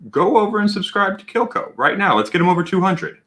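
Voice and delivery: urgent plea. Real, not acted. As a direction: push them to act now. Urging chat to subscribe.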